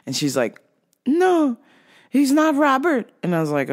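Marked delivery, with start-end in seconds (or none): 1.1-3.1 high, halting confused customer. Retold or imitated. Customer asking the clerk for the vitamin man, high and confused. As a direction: speak in halting fragments, pitched up.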